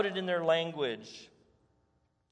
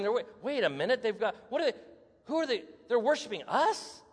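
higher, incredulous double take; dawning dismay; 5 semitones higher than usual. Retold or imitated. Apostles realising the crowd worships them, incredulous. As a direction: catch yourself in disbelief.

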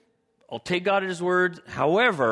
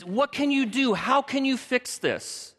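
high, exasperated complaint, drawn out; exasperated Abram; 10 semitones higher, slower than usual. Acted. Preacher playing Abram as he complains to God, high and exasperated. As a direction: whine it up at God, stretching the words.

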